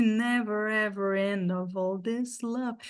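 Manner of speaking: slow, words run together; teacher models the song line; slow linked demo. Shown as a demonstration. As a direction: model the phrase slowly. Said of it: "Então, aqui".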